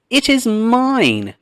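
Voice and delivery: high, loud, slow insistence; angry claim. A narrator's reading. One man fighting another over the pumpkin, angry high shout. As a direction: stake your claim on the pumpkin. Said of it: "began to fight and argue".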